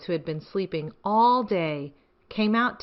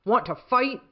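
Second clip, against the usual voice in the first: grouchy ladybug; 5 semitones higher, 5 dB louder than usual. Acted. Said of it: "said the grouchy ladybug".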